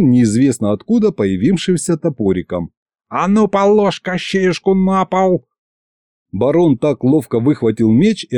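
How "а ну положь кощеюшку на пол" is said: put-on high, slow angry old-man command; old man's demand. A narrator's reading. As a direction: bark the order like a fierce grandad. Grandad ordering knights.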